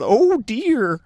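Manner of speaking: put-on frightened old man. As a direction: fret like a timid old scholar. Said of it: "So as Zax says".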